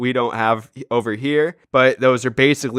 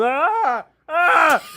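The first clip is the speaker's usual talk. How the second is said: high mock dog screams; hammed-up ghostly dog scream; 14 semitones higher than usual.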